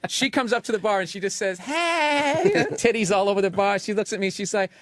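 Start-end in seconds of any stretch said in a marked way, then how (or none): 1.6-2.5 high, piercing mock girl's hey; shrill stripper voice. Mocking the stripper at the bar, high and piercing. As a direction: squeak it out like glass-breaking. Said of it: "had a voice that literally could break the glass".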